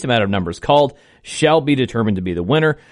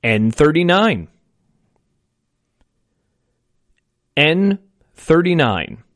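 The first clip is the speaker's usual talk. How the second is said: slow, drawn-out number calling; bingo caller; 3 semitones higher, slower than usual. Acted. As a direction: call each number slow and clear.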